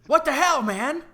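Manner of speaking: loud, high indignant yell; angry confrontation. Acted. Dwarf storming over to Anarian after losing, loud indignant yell. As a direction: bark it at the guy who cost you the bet.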